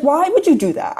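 high, loud retold protest; incredulous. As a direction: throw it back in exaggerated disbelief. Retold incredulous reply to his confession.

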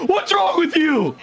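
high, slow mock wrestler line; imitating Kane. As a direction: act out the wrestler's shout. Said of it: "was like".

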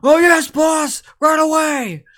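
high, loud, slow crew reply; eager crewmen shout. Acted. Crewmen shout back eagerly to their boss. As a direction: shout back like keen deckhands. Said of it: "they all go".

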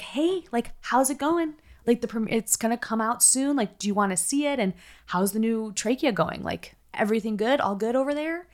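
bright, high, overly friendly; cheery fake voice. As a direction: chirp it like a fake-nice producer. Producers' cheery message voiced high.

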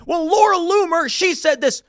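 high, loud mock excuse; mocking Bill. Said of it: "And he goes".

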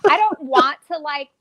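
put-on Valley girl accent, uptalk rising. Shown as a demonstration. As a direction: lilt it like a SoCal mall teen. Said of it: "this is how everybody talks".

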